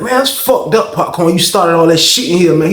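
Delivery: high-pitched scolding complaint; indignant. Retold or imitated. Inmate blaming Popcorn for the trouble, high-pitched and indignant.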